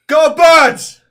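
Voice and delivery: put-on loud, high Boston accent sports cheer; Boston accent shout. Host shouting a goblin cheer in Boston accent. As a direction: bellow it like a Boston sports fan. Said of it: "Boston accent".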